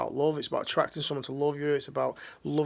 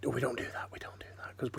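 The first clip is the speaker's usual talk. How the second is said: mock hush; 2 semitones lower, 9 dB quieter than usual. Mocking buttoned-up British attitude.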